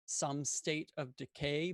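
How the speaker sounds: ordinary talk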